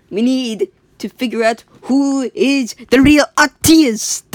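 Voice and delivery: slow, high, deliberate officer voice; a lightly voiced officer, slowly. Officers voiced in retold story.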